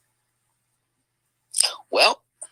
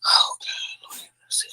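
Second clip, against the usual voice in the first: unvoiced, near-crying despair; distraught whisper. Real, not acted. Vlogger distraught over the revelation, in a crying whisper. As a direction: whisper it like you can't take any more.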